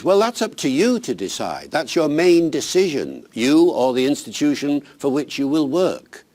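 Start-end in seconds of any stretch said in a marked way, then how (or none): none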